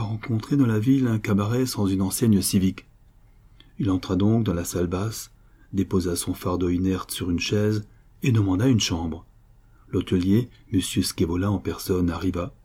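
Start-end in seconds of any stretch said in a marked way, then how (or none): none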